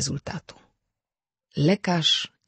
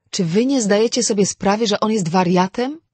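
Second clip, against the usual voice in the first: higher, louder cry to the others; calling out; 8 semitones higher, 7 dB louder than usual. A narrator's reading. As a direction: call out to them in alarm. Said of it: "zawołała Wera". (Wera woła głośno i wyżej do pozostałych)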